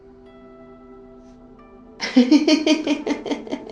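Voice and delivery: loud, high-pitched laughing fit; long peal of real laughter; burst of laughter. Real, not acted. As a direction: crack up out loud mid-meditation. Bursts out laughing mid-meditation.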